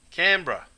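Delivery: "Canberra" clipped to "Canbra"; short "Canberra", stressed on the first syllable; the right way. Shown as a demonstration. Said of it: "Not Canberra".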